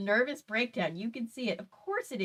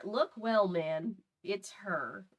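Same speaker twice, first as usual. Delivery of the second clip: low, slowing take; 3 semitones lower, 4 dB quieter, much slower than usual. Acted. Reader redoing the officer's line, low and slower. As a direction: lower voice, slowing and softening.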